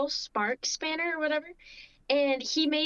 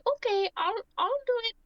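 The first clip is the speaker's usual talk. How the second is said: put-on British accent; 10 semitones higher than usual. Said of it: "you know, but in British".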